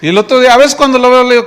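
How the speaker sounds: normal talking voice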